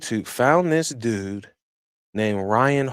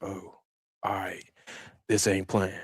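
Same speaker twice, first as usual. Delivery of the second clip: hushed realization; 4 semitones lower, 10 dB quieter than usual. Retold or imitated. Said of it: "And I was like".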